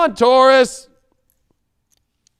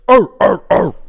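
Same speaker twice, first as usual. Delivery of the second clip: loud growling arr sounds like a juiced-up otter; otter noises; 8 dB louder than usual. Pretend. To the viewers, mocking a steroid-pumped otter with loud barking growls. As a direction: bark out otter calls, louder. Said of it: "Now I'm sounding like a fucking otter".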